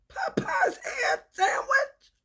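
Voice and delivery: very high, slow squeaky question; mock incredulous squeal.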